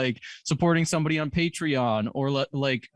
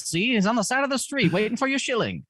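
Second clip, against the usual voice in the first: put-on old-timey voice; 7 semitones higher than usual. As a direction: hawk it like an old-timey street dealer. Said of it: "You gotta read that in an old-timey voice".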